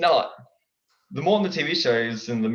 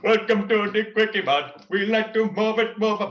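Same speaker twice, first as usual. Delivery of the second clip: King Julian voice; 7 semitones higher, 5 dB louder than usual. Shown as a demonstration. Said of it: "Unless you practice to get".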